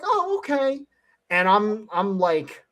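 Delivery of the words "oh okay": much higher, mock-agreeable tourist reply; imitated tourists. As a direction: answer in a high put-on voice.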